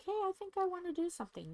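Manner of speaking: high, fast, bubbly mock voice; playful quote. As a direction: chirp it out like a sudden idea. Mock quote of her spontaneous self.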